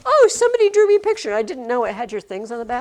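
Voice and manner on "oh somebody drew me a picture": high, loud, fast happy exclamation; delighted gush. Retold or imitated. Teacher retelling finding a student's artwork, delighted gush. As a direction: gush it quickly, much higher and louder. Quotes own thought on seeing artwork.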